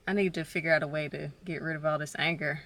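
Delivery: normal talking voice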